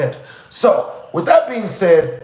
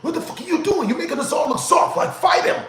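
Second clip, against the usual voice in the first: retold yelling; 9 semitones higher than usual. Driver yelling at his big friend to hurry up and hit, mock yelling. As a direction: imitate someone yelling from a distance, pitched way up. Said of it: "starts yelling at the dude".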